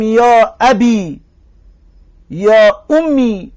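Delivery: «یا ابی» drawn out slowly, ending on a short "i"; ya dropped demo. Shown as a demonstration. Teacher demonstrating the dropped ya to students, slow. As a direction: model «یا امی» slowly, with the final ya gone. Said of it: "یا را حذف کنیم، بگیم".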